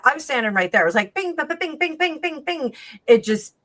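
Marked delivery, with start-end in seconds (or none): 1.1-2.7 high sung pings; ping out each jar pop brightly; imitating jar pings. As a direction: mimic the pinging jars. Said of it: "there was about fifteen pings".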